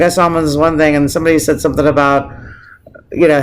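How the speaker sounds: ordinary talk, nothing marked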